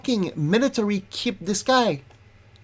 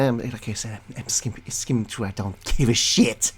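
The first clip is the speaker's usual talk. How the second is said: muttered aside; 10 semitones lower, 7 dB quieter than usual. Real, not acted. Muttering to himself.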